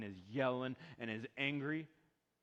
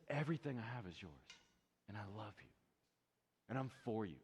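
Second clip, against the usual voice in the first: softer, lower gentle reassurance; tender father; 5 semitones lower, 5 dB quieter, faster than usual. Retold or imitated. God tells his child he's loved, tender. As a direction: say it like a loving promise. Father's loving words voiced.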